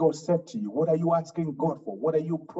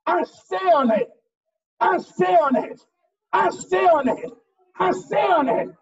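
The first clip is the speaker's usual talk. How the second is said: higher, loud, chanted declaration; full-force preaching; 12 semitones higher, 4 dB louder than usual. Acted. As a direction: rebuke the devil from the pulpit. Preaching at full force, rebuking devil.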